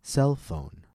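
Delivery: "Cell phone" with CELL high, phone dropping; stress on first noun. Shown as a demonstration. Teacher leading listen and repeat, "Cell phone" stressed on CELL. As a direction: lift "Cell", drop "phone". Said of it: "paying careful attention to syllable stress".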